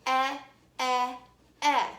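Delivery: EH said loud as short E in edge; short E sound. Shown as a demonstration. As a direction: punch out EH like the start of edge. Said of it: "When I say the short E sound".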